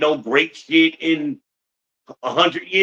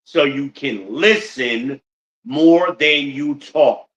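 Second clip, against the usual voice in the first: put-on slow, emphatic moral for the kids; slow punchline; 2 semitones higher, slower than usual. Shown as a demonstration. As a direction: deliver the moral slowly, like a preacher. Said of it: "punchline of a lesson".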